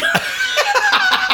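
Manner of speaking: high burst of laughter; a sharp, high cackle; loud laugh. Real, not acted. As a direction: crack up at your own joke. Laughs at own joke.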